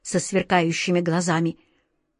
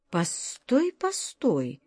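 much softer, stopping to think; hushed stop; 8 dB quieter, much slower than usual. A narrator's reading. Alyosha softly stopping his friend, much quieter. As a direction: hold him off quietly.

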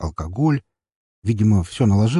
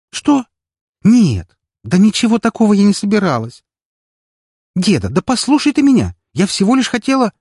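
heated quarrel; 13 semitones higher, 8 dB louder than usual. A narrator's reading. Narrated heated phone quarrel.

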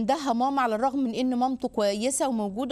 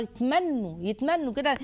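normal talking voice

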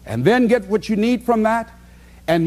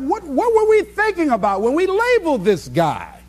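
mock puzzled labelers; 9 semitones higher than usual. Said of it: "they have to ask themselves".

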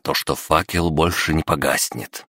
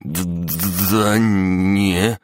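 scared stammer; 4 semitones higher, much slower than usual. Acted. Scared friend stammers through chattering teeth.

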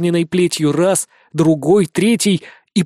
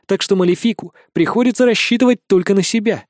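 ordinary delivery, nothing marked

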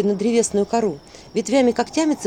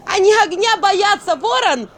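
high, loud, frantic calling out; desperate shout; 11 semitones higher, 5 dB louder, slower than usual. A narrator's reading. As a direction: scream for the raven's help. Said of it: "закричала она".

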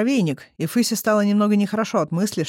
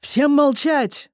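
much higher, slow booming command; thundering voice; 8 semitones higher, 4 dB louder, much slower than usual. A narrator's reading. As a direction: roar it over the whole market like a god. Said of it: "прогремел ... такой страшный голос". (громовой, медленный приказ)

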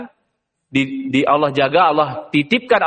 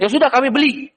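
trader agreeing; 8 semitones higher than usual. Acted.

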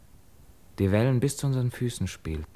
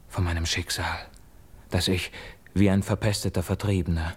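ordinary talk

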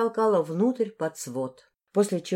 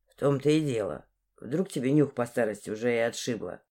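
low-pitched, needling lad; insolent young man; 7 semitones lower, 4 dB quieter than usual. Acted. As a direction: needle the old woman in a low male voice. Dmitry taunts the old woman.

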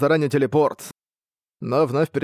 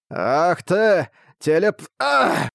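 monster cut off mid-word; slow, shocked cry; startled monster; 4 semitones higher, much slower than usual. Acted. Monster hit by arrows.

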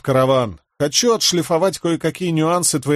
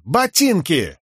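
mock Horneater demand; 5 semitones higher, much slower than usual. A narrator's reading. Fake Horneater demands boots.

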